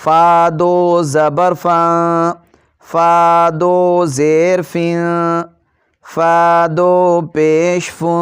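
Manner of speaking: slow, clear, even chanting of syllables; qaida recitation drill. Shown as a demonstration. Teacher drilling children in a steady recitation chant. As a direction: recite each syllable cleanly for the pupils.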